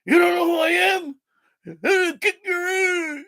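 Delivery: high, shouted raspy tough-woman threat, the last line slowed; Big Ange raspy voice. Retold or imitated. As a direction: yell it like a brash reality-show loudmouth. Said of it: "Big Ange with the raspy voice".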